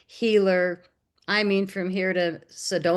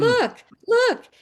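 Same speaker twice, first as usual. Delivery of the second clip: insistent look; 6 dB louder than usual. Retold or imitated. Pushing images at shop clerks.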